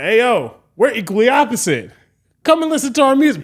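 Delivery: high, loud shout to a crowd; stage hype call. Retold or imitated. Band introduces itself to festival passersby, loud hype call. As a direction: imitate an emcee announcing the band.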